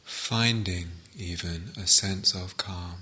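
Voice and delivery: calm meditation guide with long pauses between phrases; guided meditation. Real, not acted. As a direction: settle listeners into stillness, phrase by unhurried phrase.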